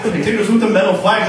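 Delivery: ordinary delivery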